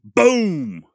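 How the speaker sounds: high, loud drawn-out "boom"; booming mock gun blast; imitated gunshot boom. Imitated shotgun blast in retold scene.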